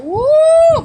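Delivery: a high, loud whoop; excited woo. Retold or imitated. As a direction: whoop it out like the crowd just saw something wild. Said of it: "I said".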